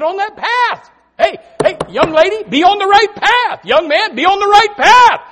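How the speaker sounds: high, loud exhortation; loud high call. Real, not acted. Preacher calling young lady and young man, loud high exhortation.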